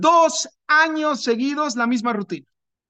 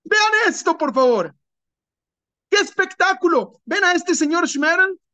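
higher, eager summoning of the angels; proud father showing off his son, higher; excited calling; 7 semitones higher than usual. Acted. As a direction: call everyone over to see, thrilled. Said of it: "Llamo a todos los malachim y les digo".

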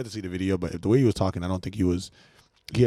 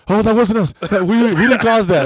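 high, loud mocking whine of the recorders; mock whiny excuse; 12 semitones higher, 11 dB louder than usual. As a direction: squeal it like guilty kids denying it.

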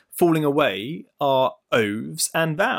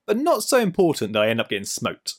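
ordinary delivery

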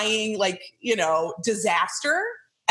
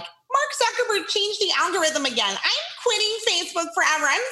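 high whiny mock rant; mocking complaint; 10 semitones higher than usual. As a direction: parody a user quitting Facebook in a huff. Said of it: "one of my pet peeves is when people get on Facebook and they're like".

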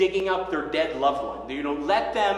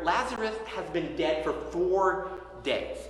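normal talking voice

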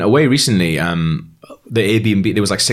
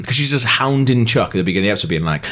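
ordinary delivery

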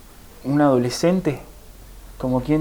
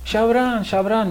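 higher-pitched retold call; 7 semitones higher than usual. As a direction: call his name twice, pitched up. Retold calling out to Abraham. (llamado imitado, en tono agudo)

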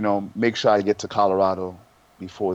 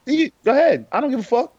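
loud; 13 semitones higher, 4 dB louder than usual. Real, not acted. Heated podcast banter, threat.

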